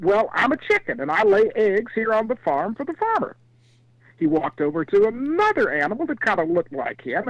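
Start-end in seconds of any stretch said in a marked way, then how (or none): 0.0-3.4 higher storybook chicken voice; chicken character. Acted. Chicken explains its job to zebra, high voice. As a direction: lift the pitch, simple farm-animal answer. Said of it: "And the animal said".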